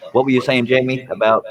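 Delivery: usual speaking voice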